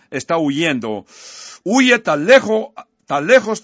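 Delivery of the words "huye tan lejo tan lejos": louder and higher, pushing the words out; raised preacher's emphasis on tan lejos; emphatic call. Real, not acted. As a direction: drive home how far he ran. Preacher building emphasis on distance.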